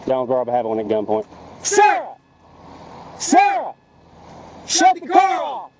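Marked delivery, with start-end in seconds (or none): 1.6-5.7 shouting commands. Real, not acted. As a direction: yell orders across the road. Officer shouting commands at suspect.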